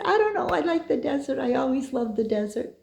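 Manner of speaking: high, sheepish and innocent; sheepish reply. Retold or imitated. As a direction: answer like a clueless tourist. Her own sheepish reply retold.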